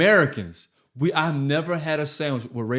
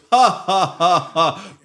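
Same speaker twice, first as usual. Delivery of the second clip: loud, higher imitation of a laugh; mock laugh; 4 semitones higher, 5 dB louder, slower than usual. Retelling Michael Jordan's reaction, mock laugh. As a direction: act out his laugh in separate loud ha's. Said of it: "He goes".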